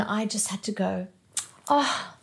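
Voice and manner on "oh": forced cheerful smile. Retold or imitated. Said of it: "the big Sunday smile".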